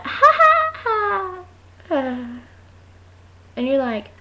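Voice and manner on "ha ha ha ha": fake laugh. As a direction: force out a mock laugh, letting it fall. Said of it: "They'll just do that".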